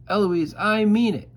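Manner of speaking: high, loud mock shout of a tutor. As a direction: bellow the warning. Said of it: "he shouts".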